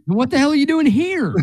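incredulous outburst. Pretend. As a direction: squeak it out in disbelief. Voicing incredulous retort to girl.

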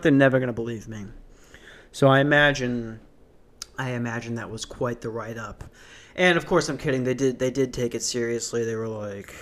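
ordinary delivery

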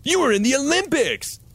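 mock awe. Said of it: "said Ron".

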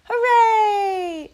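loud high joyful cheer; shouting. Acted. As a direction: cheer at the top of your voice. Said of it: "shouted Little Beaver".

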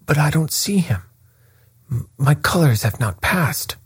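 agitated. A narrator's reading. Colonel searching the runners for his colours, agitated. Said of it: "in great agitation".

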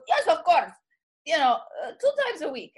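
emphatic mock Puerto Rican wife; Puerto Rican accent. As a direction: imitate the wife's Puerto Rican accent, punching the answer. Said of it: "pretending to be the Puerto Rican wife".

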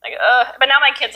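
usual speaking voice

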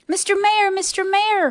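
high, repeated call; urgent calling. A narrator's reading. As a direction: call down to the tiny mayor. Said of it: "Horton called".

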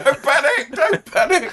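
high, comic flapping catchphrase; hammed-up panicky corporal; mock panic. Corporal urges everyone not to panic, high mock panic.

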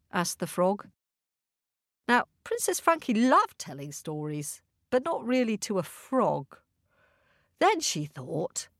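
plain reading voice

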